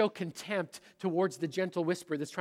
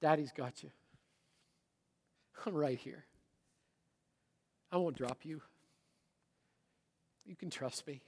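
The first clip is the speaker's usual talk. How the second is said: hushed reassurance, growing softer; soft fatherly whisper; 6 dB quieter than usual. Retold or imitated. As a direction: re-enact a dad murmuring close to his child. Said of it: "I used to love to whisper to my kids".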